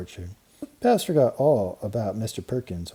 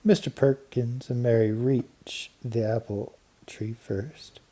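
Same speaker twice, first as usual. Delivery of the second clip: ordinary delivery, nothing marked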